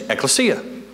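a lightly put-on air of expertise on Ekklesia, rising at the end; mock show-off Greek speaker. Pastor jokes about impressing people with Greek, a light mock show-off. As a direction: say Ekklesia like you're showing off your Greek, half-joking. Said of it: "And all you have to do is say".

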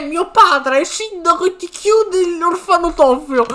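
lightly voiced upset little boy, slow; a kid close to tears telling on the bully, slowed down; upset child voice. Streamer voicing Shiro telling Kiryu about the bully, lightly voiced upset boy. Reading the crying orphan's subtitles.

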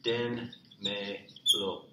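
very slow "Dénmelo" with gaps; teacher's slow word breakdown; syllable by syllable. Shown as a demonstration. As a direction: sound out "Dénmelo" one drawn-out syllable at a time. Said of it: "You all give it to me".

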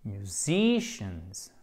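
very slow "musicians" with stressed ZI and final z; plural model. Shown as a demonstration. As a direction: stretch "musicians" out, sounding the plural ending. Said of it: "on rajoute un S qui nous donnera". (« musicians » très lent, pluriel en « z » audible)

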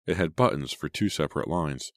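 ordinary delivery

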